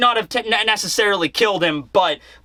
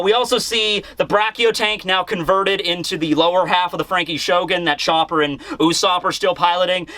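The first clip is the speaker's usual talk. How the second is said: normal talking voice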